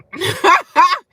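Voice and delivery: loud, high burst of laughter. Real, not acted. Laughs after calling herself bum.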